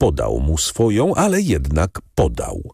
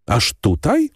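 higher, rising disbelief on "Aż tutaj?"; surprised question; 10 semitones higher than usual. A narrator's reading. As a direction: ask it with raised, incredulous pitch. Albert asks, surprised. (zdziwione pytanie „Aż tutaj?” z wznoszącą intonacją)

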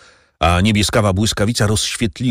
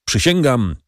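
usual speaking voice

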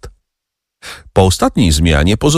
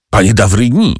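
mildly raised call by name; 3 semitones higher, 4 dB louder than usual. A narrator's reading. Prosecutor calls to the doctor, mildly raised cry. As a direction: call out to him, only a little louder. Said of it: "zawołał Villefort".

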